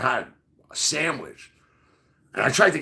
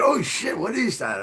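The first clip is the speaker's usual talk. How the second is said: startled; 7 semitones higher than usual. Retold or imitated. Startled at seeing the axe.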